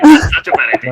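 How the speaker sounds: high, loud mock panic; mock alarmed thought. Player replaying her regret over suggesting the devil's bargain, high and loud mock panic. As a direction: act out your own instant regret. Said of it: "immediately I was like".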